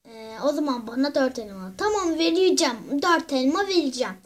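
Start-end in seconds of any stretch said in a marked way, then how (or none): 0.1-1.7 grumpy creature voice. Acted. Same low creature voice bargaining.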